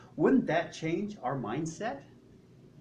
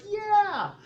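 high, loud whooped answer; crowd cheer; 5 dB louder than usual. Acted. Crowd answering the tightrope walker, excited cheer. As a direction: cheer back like an eager crowd.

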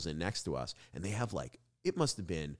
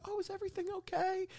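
much higher, fast, fussy mom voice; mock mom voice; 5 dB quieter, faster than usual. Mocking the girlfriend's mom on the phone, high worried voice. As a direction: play the worried mom in falsetto. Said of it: "The mom's like".

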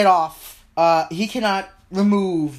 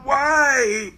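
anguished cry; 6 semitones higher than usual. Acted. Character cries out.

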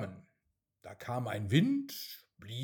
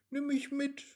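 much higher, small pleading voice; eager raindrop. A narrator's reading. Raindrop asks the wind to take it along, eager and high. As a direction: ask brightly like an eager child.

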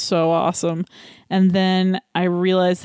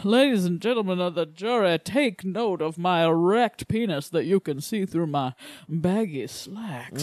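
honeyed southern lawyer drawl. Acted. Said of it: "Turned us into, like, Southern lawyers".

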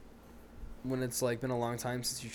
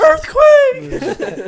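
very high, very loud mock panic; mock alarm shout; 18 dB louder than usual. Friend joking about the shaking table, shrieked mock alarm. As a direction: shriek a joke warning.

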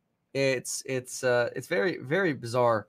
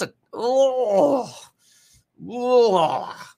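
dismayed groan; 10 semitones higher, 4 dB louder, much slower than usual. Real, not acted. Ford collapsing ten percent.

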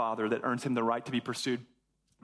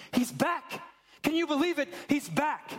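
excited news; 4 dB louder than usual. Acted. Servant breaking news to brother.

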